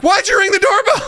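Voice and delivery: very high-pitched, hammed-up exasperation; aggressive reaction. Dad ignoring the box to question daughter, exasperated. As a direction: imitate a parent losing it over nonsense. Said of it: "I have an aggressive reaction to that".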